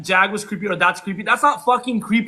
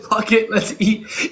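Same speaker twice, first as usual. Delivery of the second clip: laughing; much slower than usual. Retold or imitated. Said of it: "You said".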